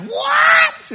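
a very high, very loud shrieked "What?"; outraged shout. Retold or imitated. Mom shrieking on phone at three thousand dollars lost. Mom hears amount invested.

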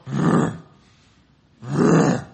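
loud rumbling mock growl; bear growl. Pastor scares the campers from the bushes with a bear growl. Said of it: "I would sound like a bear in the woods".

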